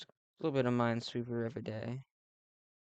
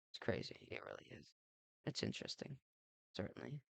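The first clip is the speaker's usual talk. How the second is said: breathy whispered murmur to himself; whispered aside; 12 dB quieter, faster than usual. Real, not acted. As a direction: drop to a whisper, half to yourself.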